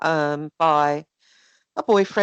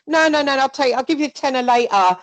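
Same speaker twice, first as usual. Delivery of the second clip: higher and louder, pushy and breezy; mock pushy taker; 7 semitones higher, 6 dB louder, faster than usual. Acting the grabber at a friend's house, higher, louder and breezy. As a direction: brush off the objection, grabbing it anyway. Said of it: "like, literally".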